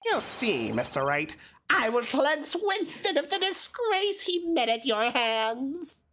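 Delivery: high, slow, sneering rival lawyer; smug prosecutor voice. Acted. Voicing the prosecutor Payne.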